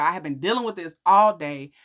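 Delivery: ordinary talk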